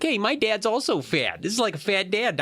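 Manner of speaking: high-pitched mock child; imitated kid reader. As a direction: pipe up like a kid relating to the book. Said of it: "kids who read the books".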